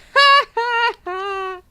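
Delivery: loud, high cackle; hysterical laugh. Real, not acted. Laughing at a chat comment.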